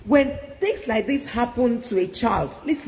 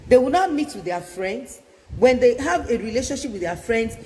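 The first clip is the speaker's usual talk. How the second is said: ordinary delivery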